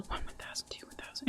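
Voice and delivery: whispering the seconds while curling hair; whispered counting. Shown as a demonstration. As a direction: whisper the count under your breath. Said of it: "demonstrate what that looks like".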